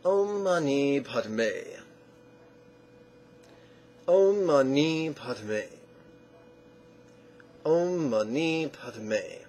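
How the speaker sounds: "Om Mani Padme" very slow, syllables linked evenly; syllable stacking. Shown as a demonstration. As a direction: model "Om Mani Padme" with long vowels and a falling end. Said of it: "Five syllables".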